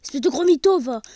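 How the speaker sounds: loud teasing insult; mock scolding. Gamer loudly teasing his own fake boast. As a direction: call him out, laughing at yourself. Mocks himself as liar.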